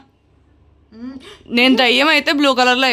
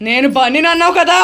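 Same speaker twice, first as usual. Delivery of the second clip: higher, climbing pitch to the end; insistent question; 4 semitones higher than usual. Pretend. Ghost asks about Bunny, higher and insistent. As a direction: press the question with a rising voice.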